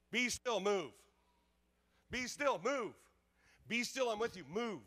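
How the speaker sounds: high, loud, punched commands; fiery preaching. Acted. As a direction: drive each "move" home to the crowd. Preaching at full force.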